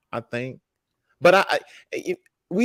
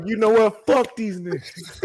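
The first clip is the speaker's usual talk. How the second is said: higher, then slow, with a laugh breaking in; retold fed-up brush-off, pitched up; mad dismissal; 9 semitones higher, slower than usual. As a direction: throw it away in half-laughing anger. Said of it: "I was mad".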